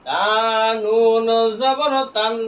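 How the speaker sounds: very slow, with নুন held long; slow model spelling for learners to follow; drawn-out spelling recitation. Shown as a demonstration. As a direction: stretch each letter name into the next. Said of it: "এই তা দেয়া নুনের উপর কি করছি?".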